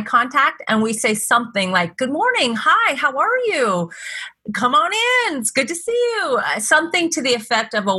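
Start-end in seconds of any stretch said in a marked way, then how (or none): 2.0-6.4 much higher, bright welcoming hello; cheerful greeting. Shown as a demonstration. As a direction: welcome each guest with joy. Greeting homeless guests with joy.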